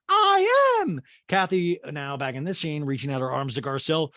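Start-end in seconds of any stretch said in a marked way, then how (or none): 0.1-1.0 very high, loud falsetto retort; hammed-up piercing comeback; mock Diana. Diana answers Garcelle's rebuke, piercing mock falsetto.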